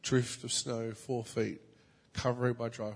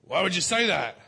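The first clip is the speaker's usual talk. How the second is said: mock angry neighbour; 7 semitones higher, 9 dB louder than usual. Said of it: "He's like".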